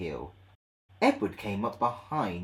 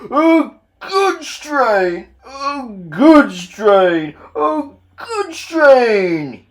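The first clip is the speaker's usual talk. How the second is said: very slow, loud, high strained groan; groaning Gordon; 13 semitones higher, 14 dB louder, much slower than usual. Acted. Tired engine groaning under dirty trucks. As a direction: drag it out like heavy work. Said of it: "he groaned".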